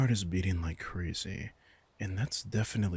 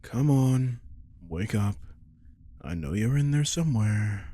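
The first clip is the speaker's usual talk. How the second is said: louder, urging them awake; calling out; 3 semitones higher, 5 dB louder than usual. Acted. Vampire rousing her familiar from the trance, calling out. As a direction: call into their daze to pull them out.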